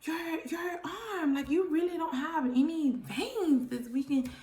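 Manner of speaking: lightly voiced, baffled nurse; puzzled nurse voice. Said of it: "So they was like".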